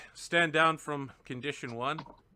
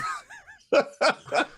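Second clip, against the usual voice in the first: burst of laughter; 13 semitones higher, 8 dB louder than usual. Real, not acted. Breaks up mid-read.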